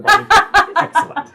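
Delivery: high, slow, giggly dainty lady; a twittering townswoman breaking up; sweet lady voice. Acted.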